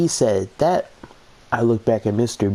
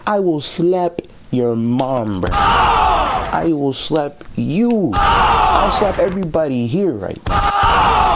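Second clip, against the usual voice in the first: slow, drawn-out shouted threats, louder and higher; mock furious shout; 6 semitones higher, 3 dB louder, much slower than usual. Student playing an inner rant after being pushed, mock furious shout. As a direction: yell it slowly like a hammed-up threat. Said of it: "in my head, I'm just like".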